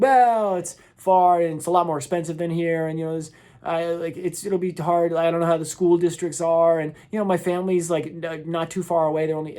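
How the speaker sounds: imitated excuse-maker, opening on a high, loud "well"; making excuses. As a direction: hem and haw through a list of excuses. Mimicking people listing excuses.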